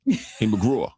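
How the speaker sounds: imitated New York accent. Shown as a demonstration. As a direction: greet him like a New Yorker. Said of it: "the New York accent".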